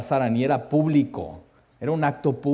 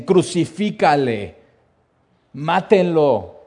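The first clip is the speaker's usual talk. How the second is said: loud, slow, drawn-out cry for crucifixion; crowd shouting; 3 semitones higher, 5 dB louder, slower than usual. Retold or imitated. Preacher voicing the crowd demanding crucifixion, loud slow shout. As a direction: yell it out like an angry mob. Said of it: "volvieron a dar voces".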